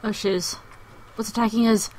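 ordinary talk, nothing marked

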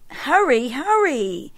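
high, loud hurrying; loud, high, impatient hurry hurry; impatient call. A narrator's reading. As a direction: urge them on, fed up with waiting. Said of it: "said Sun".

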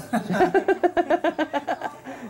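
long, high-pitched burst of laughter; hearty laugh. Real, not acted. Amused on hearing about the grandchild.

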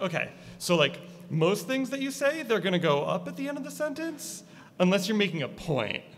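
high, lilting upspeak, each line rising at the end; sing-songy cadence. Shown as a demonstration. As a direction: teach the rising lilt, pitched high. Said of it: "a really sing-songy way to, like, talk".

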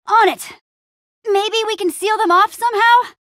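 high, pressed voice; urgent combat call. Acted. Adventurer sealing off spiders, urgent combat call.

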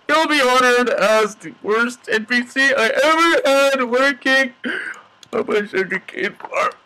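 high, choked-up mock tribute; mock emotional. Player's eulogy for worst NPC ever, mock emotional. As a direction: pitch climbing as if overcome with grief. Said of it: "I'm getting emotional".

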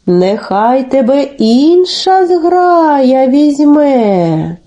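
very slow, drawn-out goose voice; refusing geese. Acted. Geese turn Telesyk down, slow drawn-out refusal. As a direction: stretch every vowel like a passing flock. Second flock refuses him too.

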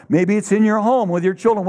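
ordinary talk

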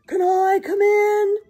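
high, very loud, puffed-out call; puffing bear. A narrator's reading. Bear knocks at the door, puffing loudly. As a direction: puff it out loudly as a storybook bear. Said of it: "and puffed".

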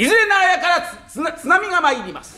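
high, stumbling formal announcement; bumbling recital. Acted. Clumsy husband blurts memorised greeting.

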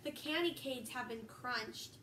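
a steady read-aloud storybook voice; reading to children. Acted. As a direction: read it to the kids like a bedtime story. Reading picture book aloud.

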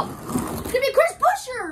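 high-pitched finish-line call; excited race call. Real, not acted. Commentator calling the diecast race finish, excited. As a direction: call the winner with a burst of excitement.

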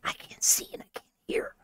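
frightened hushed whisper. Real, not acted. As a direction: breathe it out in a scared whisper. Blinded in the dark game.

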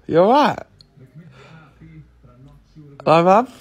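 loud, cheeky call. Real, not acted. Child greets mum on camera, calling out loudly. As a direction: project it loudly at someone ignoring you.

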